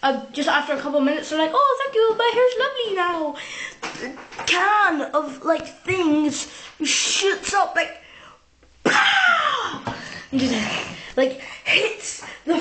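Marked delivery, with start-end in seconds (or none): 1.5-3.4 high, gushing thank-you; the customer thanking the hairdresser, high and gushing; mock delighted thanks. 8.8-9.8 high shouted bang sound; loud pow. Retold or imitated. Hairspray can shoots up.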